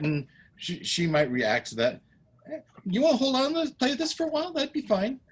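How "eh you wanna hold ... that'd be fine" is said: very high, coaxing voice; friendly visitor. Acted. Visitor offers girl a toy, coaxing. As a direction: offer it lightly in a pitched-up voice.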